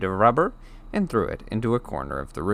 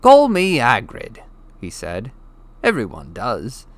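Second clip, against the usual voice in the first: friendly giant; 3 semitones higher, 5 dB louder than usual. Acted. Hagrid tells Harry what to call him, hearty. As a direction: tell him plainly and warmly.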